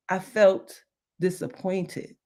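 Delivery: normal talking voice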